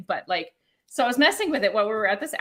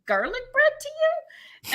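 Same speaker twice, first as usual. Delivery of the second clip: high, slow, disbelieving; mock-baffled high question; high incredulous question; slower than usual. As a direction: pitch it way up, baffled. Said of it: "I'm like".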